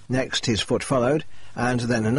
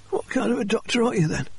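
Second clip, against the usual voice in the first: a much higher, pained voice for the injured Jacob; injured man's voice; 12 semitones higher than usual. Acted. Injured Jacob pleading with the doctor, higher pitched voice. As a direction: voice Jacob in strained higher register. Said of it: "Jacob said".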